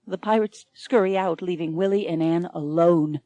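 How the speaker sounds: usual speaking voice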